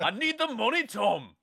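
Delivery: high-pitched hammed-up Northern Irish accent; mock Northern Irish plea; Brad Pitt's Northern Irish. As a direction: send up a film star's bad Irish accent. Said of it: "Brad Pitt doing an impression of Northern Irish".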